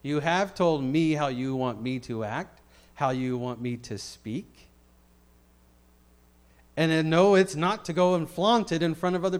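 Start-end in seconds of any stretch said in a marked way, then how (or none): none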